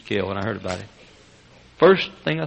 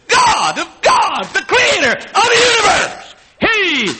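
high, loud fervent preaching, building to shouts; preaching at full force; 8 dB louder than usual. Acted. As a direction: thunder it at the congregation.

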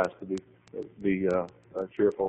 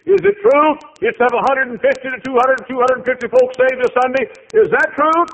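high, loud, excited; eager questioning; 12 dB louder than usual. Retold or imitated. Visitor quizzes receptionist about glory days, high and excited. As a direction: pepper her with eager questions.

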